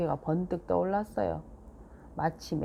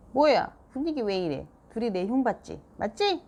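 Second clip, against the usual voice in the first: louder, higher suspicious questions; suspicious mom; 4 semitones higher, 3 dB louder than usual. A narrator's reading. Mom bursting in on dad and Seoyeon, suspicious. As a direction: barge in accusing them.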